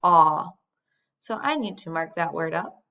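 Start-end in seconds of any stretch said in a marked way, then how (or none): 0.0-0.5 "aw" said as a short open vowel; short vowel demo. Shown as a demonstration. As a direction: isolate the short "aw" sound. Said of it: "its short vowel sound aw".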